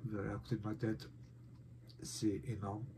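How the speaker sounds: usual speaking voice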